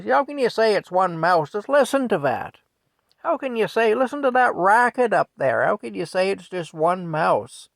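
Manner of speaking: high, loud, incredulous tirade; exasperated shouting at her husband; yelling wife. Retold or imitated. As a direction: demand an answer at full holler. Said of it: "she yells at Frank".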